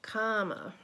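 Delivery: plain reading voice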